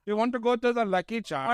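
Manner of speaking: high-pitched mock Indian-Irish accent. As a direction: imitate a botched Irish accent that comes out Indian.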